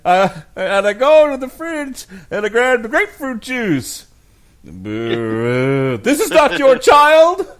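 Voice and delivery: high, loud mock Cosby, laughing through it; hammed-up Cosby voice; Cosby impression. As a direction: belt the punchline high and laughing.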